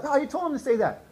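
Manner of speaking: high, loud indignant retort; mimicked accusation. Wife blaming husband for daughter's words, imitated high and loud. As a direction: fling the blame back in a high imitation.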